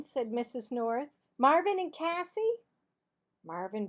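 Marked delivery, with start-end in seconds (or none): 1.4-2.6 high, loud stern teacher call; scolding teacher. Acted. Mrs. North to the class, high scolding call. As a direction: snap at the chatty pair.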